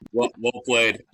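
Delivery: retold guard laughing through well played. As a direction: chuckle it out, amused. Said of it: "He just starts laughing".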